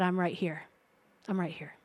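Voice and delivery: soft, low, tender I'm right here; gentle reassurance. Retold or imitated. Jesus quietly reassuring the man he is near, soft and tender. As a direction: reassure him quietly, close by.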